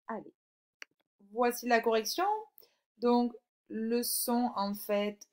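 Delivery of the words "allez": usual speaking voice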